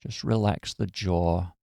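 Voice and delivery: calm, even meditation voice with long pauses; guided meditation. Acted. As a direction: guide them gently into relaxation, phrase by phrase.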